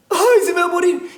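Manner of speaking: high, whiny mock lament; mock wailing. Preacher mocks a relative wailing over cancer. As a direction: imitate the panicked relative, high. Mocked fearful cancer patient.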